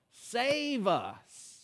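high, stretched-out cry. Retold or imitated. Preacher voicing children in the temple, crying out. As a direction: call it out like pleading children. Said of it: "the children were crying out".

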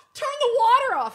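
high loud urgent instruction; urgent friend. Retold or imitated. Friend ordering her from doorway, high urgent voice. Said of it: "she's like".

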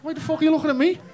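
mock indignation. Husband to wife, squealing mock alarm.

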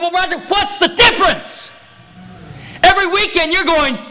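high, forceful comeback; indignant retort. Retold or imitated. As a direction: throw the accusation right back. Acted retort to his accuser.

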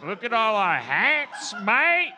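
slow, loud, hammed-up Aussie; mock Australian accent. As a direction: stretch it out as a caricature Australian, rising high. Hammed-up Australian convict character.

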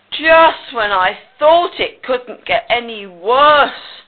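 loud, slow, drawn-out groan; put-upon, miserable pig; groaning complaint. Acted. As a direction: groan it out in despair. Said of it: "groaned Pig".

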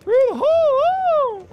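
very high, drawn-out falsetto; silly high echo. Pretend. Player echoing a teammate's callout, silly falsetto. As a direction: echo it in a slow, piping falsetto.

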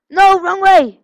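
loud, high annoyed shout; frustrated yell. Real, not acted. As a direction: shout it at the screen. Yelling at game going wrong.

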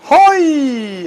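long high falling holler; cumbia whoop. Acted. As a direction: let out a festive DJ cry. Cumbia whoop after announcer intro.

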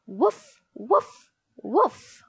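short rising woofs; mock dog bark. A narrator's reading. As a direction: bark like a puppy in spoken woofs. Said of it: "Pal barked at him".

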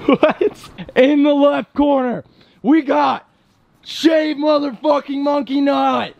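high, slow hyped ring announcer. Pretend. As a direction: boom out the fighter's name. Announcing fighters mockingly.

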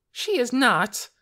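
high, loud and slow protest; a girl's stung, furious denial; indignant outburst. Acted. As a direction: shout it down, refusing to believe it. Lena denies sister will die.

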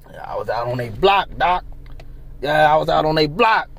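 low, mumbled mock patient voice; lightly voiced injured guy telling the doctor; mock Hassan. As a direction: play him down low and vague, brushing it off. Mocking Hassan answering the doctor.

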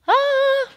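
high, drawn-out "ah" of amazement; startled gasp. Acted. Storyteller reacting to Paul's bright light, startled gasp. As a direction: gasp aloud, high, at a sudden sight.

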